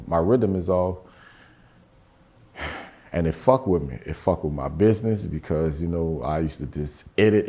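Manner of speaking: ordinary talk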